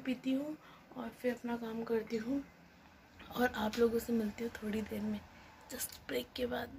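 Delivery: soft unvoiced whisper. Real, not acted. Vlogger whispering goodbye to viewers before a break. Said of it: "unvoiced whisper".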